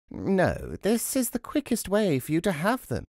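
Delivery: lightly voiced old woman. A narrator's reading. Old woman insisting to the wife, lightly characterised.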